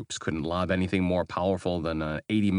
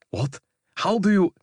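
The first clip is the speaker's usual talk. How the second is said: much higher and louder, startled; shocked; 13 semitones higher, 7 dB louder than usual. Acted. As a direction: blurt it out in shock. Baylor shocked, eyes bulged.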